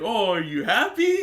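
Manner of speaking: high, loud, swooping cartoon voice; exaggerated sing-song imitation; Elfin. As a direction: ask with hammed-up cheer. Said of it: "And Elfin's like".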